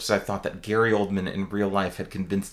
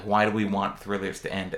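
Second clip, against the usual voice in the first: ordinary talk, nothing marked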